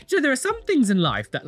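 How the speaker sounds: ordinary talk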